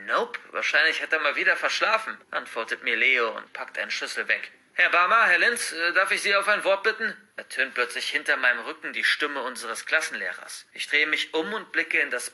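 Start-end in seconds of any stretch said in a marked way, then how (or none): 4.7-7.1 calling out. Acted. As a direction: call them over. Teacher calls from behind.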